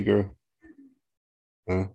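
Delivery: ordinary talk, nothing marked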